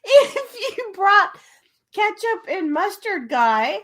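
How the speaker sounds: high and slow through laughter; defending her hot dog idea while laughing. Real, not acted. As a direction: explain while cracking up. Laughing explanation.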